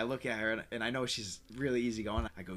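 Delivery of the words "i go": normal talking voice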